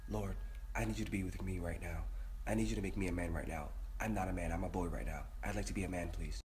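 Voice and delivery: low, soft plea to God; quiet, low pleading mutter; hushed prayer. Real, not acted. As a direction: pray under your breath for courage. Prays for courage before acting.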